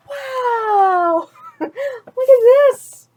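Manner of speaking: high, loud, laughing wonder; real delight. Real, not acted. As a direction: gasp at the picture with a laugh. Reader delighted at the picture.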